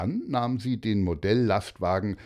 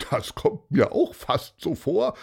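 higher-pitched put-on voice, amused; Paulus's character voice; 7 semitones higher, 4 dB louder than usual. Paulus agreeing with Pieper, in a higher put-on voice. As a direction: answer knowingly, pitched up.

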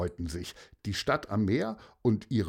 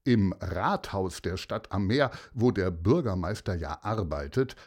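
plain reading voice

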